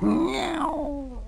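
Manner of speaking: very high, drawn-out mew; a long sad meow from a tired cat. Acted. Old cat mewing in despair to the donkey.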